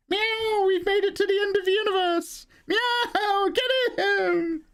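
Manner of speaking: very high, tiny cat characters cheering; a cartoon cat rallying the others, high and squeaky. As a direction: squeak it out like a heroic kitten. Cats voiced as characters.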